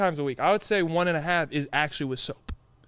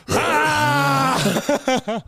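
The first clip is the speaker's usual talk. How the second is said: lion roar; 7 semitones higher than usual. Pretend. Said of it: "see who's more intimidating".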